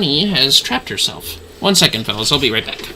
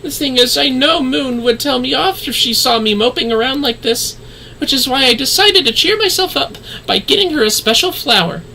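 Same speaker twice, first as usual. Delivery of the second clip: put-on higher, downcast young woman's voice; voiced NPC girl; 10 semitones higher than usual. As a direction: play the gloomy girl asking a favour, pitched up. Streamer voicing a sad NPC.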